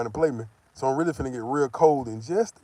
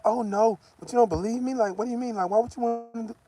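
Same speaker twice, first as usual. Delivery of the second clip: higher, imitating the girlfriend's protest; mock girlfriend voice; 9 semitones higher than usual. Imitating his girlfriend protesting his doubts, higher mock voice. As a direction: play her up as defensive and pleading.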